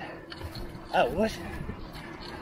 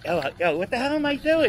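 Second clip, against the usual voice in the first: ordinary talk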